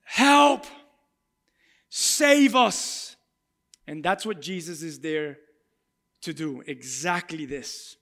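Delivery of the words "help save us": loud cry for help; crowd yelling. Retold or imitated. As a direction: call out desperately for rescue. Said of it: "the crowds are yelling".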